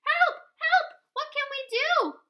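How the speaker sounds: high, loud frantic calling; panicked cry for help. Acted. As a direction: scream for help at a burning shed. Townsfolk panic at the fire.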